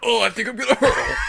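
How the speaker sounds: high, loud laughing space marine shout; Halo Marine impression. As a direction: bark it like a queasy marine. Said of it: "That's my Halo Infinite Marine impression".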